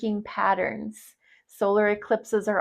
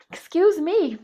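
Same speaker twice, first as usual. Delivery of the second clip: high, loud just after a sneeze; apologizing after a sneeze; right after sneezing; 8 semitones higher, 4 dB louder than usual. Real, not acted. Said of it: "that sneeze was at the most perfect time".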